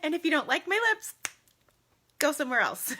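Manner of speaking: high, loaded mock-sweet brush-off; playfully snotty. Streamer telling off lipstick critics, playfully snotty. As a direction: brush off the critics, half laughing. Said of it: "I don't mean to sound, like, snotty".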